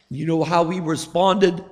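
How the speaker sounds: ordinary delivery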